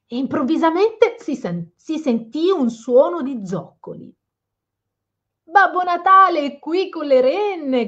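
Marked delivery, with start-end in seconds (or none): 5.5-7.9 high, loud excited shout; an elf yelling the big news; shouted announcement. Acted. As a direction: call out to everyone that Santa has arrived. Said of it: "gridò qualcuno".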